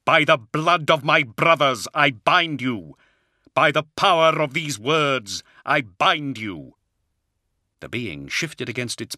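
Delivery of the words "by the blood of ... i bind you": raised voice, commanding an unholy thing; ringing incantation, pitched up; forceful cry. A narrator's reading. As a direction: cry out each line of the binding. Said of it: "he cried".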